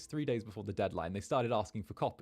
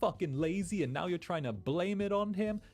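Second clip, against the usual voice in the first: higher-pitched imitated tirade; ripping into them; 6 semitones higher than usual. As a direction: act out a friend's indignant telling-off. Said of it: "she started ripping into them".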